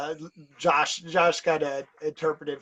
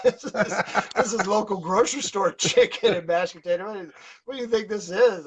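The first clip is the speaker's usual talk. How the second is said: laughing retort; 5 semitones higher, 3 dB louder than usual. Retold or imitated. Mock retort to crew, laughing.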